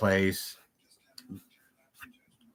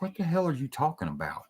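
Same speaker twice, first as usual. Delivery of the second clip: shocked disbelief; 6 semitones higher, 8 dB quieter than usual. Retold or imitated. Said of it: "And I'm like".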